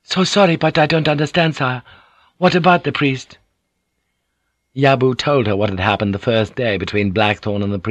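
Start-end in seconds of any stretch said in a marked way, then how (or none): 0.0-3.4 high-pitched, deferential woman's voice; puzzled interpreter addressing her lord; Mariko, politely puzzled. Acted. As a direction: ask apologetically, not understanding.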